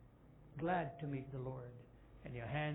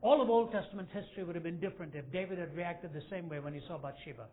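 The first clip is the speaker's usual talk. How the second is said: louder, urging challenge; emphatic preaching; 3 semitones higher, 6 dB louder than usual. Acted. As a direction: drive the point home. Preacher presses the point.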